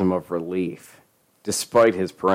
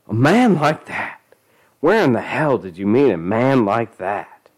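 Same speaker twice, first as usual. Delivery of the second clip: angry scolding; 4 semitones higher, 4 dB louder than usual. Acted. Angry mother.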